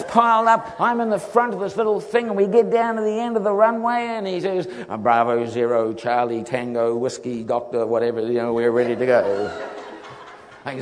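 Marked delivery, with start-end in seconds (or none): 4.9-9.7 lower, clipped radio chatter; pilot radio call. Retold or imitated.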